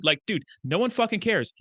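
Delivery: usual speaking voice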